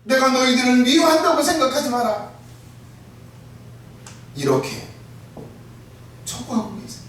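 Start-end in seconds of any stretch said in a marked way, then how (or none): 0.0-2.3 Jesus urging repentance. Acted. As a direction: plead and command at full force.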